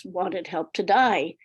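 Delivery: normal talking voice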